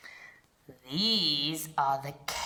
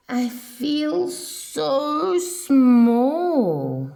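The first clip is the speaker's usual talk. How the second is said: slow, high, louder snail voice, full of wonder; tiny snail marvelling aloud; small awed voice; 6 semitones higher, 6 dB louder, much slower than usual. Acted. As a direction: stretch it out in awe, a tiny creature. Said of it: "And she said to the whale".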